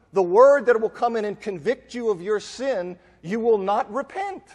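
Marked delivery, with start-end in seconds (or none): none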